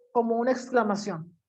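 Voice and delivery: ordinary delivery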